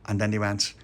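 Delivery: usual speaking voice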